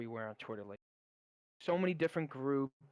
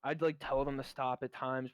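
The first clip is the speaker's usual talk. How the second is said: usual speaking voice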